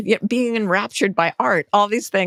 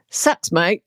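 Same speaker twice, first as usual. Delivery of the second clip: blunt Australian "sucks, mate"; laid-back Australian shrug; Australian accent. Retold or imitated. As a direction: toss it off like an Aussie mate. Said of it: "this is Australian therapy".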